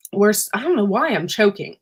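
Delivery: ordinary talk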